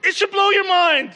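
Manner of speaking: high, loud and fervent; fired up. Acted. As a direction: preach it with fire. Preacher fervent.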